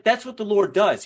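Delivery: ordinary talk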